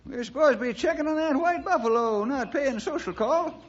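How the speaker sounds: put-on high, cranky old-timer voice; grumbling old-timer pressing his partner for answers; old sidekick. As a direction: play the fussy old ranch hand, pitched high.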